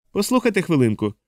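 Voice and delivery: lightly voiced decisive interjection; resolute. A narrator's reading. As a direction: cut in with mild resolve. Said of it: "промовив рішучим голосом Моро".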